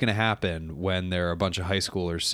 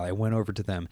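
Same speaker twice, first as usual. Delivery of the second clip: ordinary talk, nothing marked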